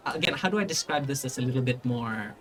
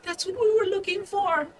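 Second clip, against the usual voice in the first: put-on very high, squeaky boy's voice; frightened boy. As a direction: blurt it in a scared falsetto. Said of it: "just kind of frightened there".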